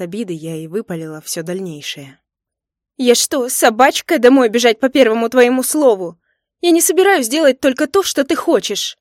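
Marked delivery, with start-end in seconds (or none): 3.0-9.0 loud, high furious tirade; indignant outburst. A narrator's reading. Hurt wife refusing to obey husband, indignant outburst. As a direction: rail at him, voice raised.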